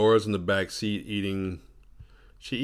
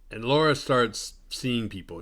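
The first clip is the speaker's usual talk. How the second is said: usual speaking voice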